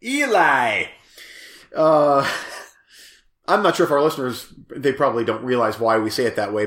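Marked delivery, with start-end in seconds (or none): 0.0-0.9 "Eli" said with the first E stretched and stressed. Shown as a demonstration. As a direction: lean hard on the E of "Eli". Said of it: "on the E".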